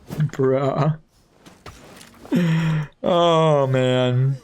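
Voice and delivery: usual speaking voice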